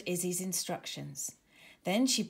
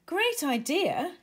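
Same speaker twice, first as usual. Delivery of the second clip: high, slow, pleased; delighted praise; 8 semitones higher, 4 dB louder, slower than usual. Acted. Izzy praising Danny's chalk idea, delighted. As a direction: savour the good idea. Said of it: "said Izzy".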